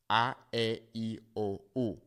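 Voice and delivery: A, E, I, O, U as ah, eh, ee, oh, oo; A, E, I, O, U in pure Spanish vowels, one by one; Spanish-sounding A, E, I, O, U. Shown as a demonstration. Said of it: "In Spanish".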